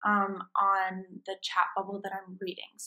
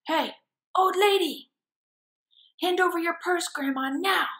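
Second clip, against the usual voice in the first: higher, louder bullying shout; thug's demand; 8 semitones higher, 6 dB louder than usual. Acted. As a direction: mugger barking at grandma. Gangster mugging an old lady.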